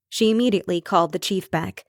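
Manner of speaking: usual speaking voice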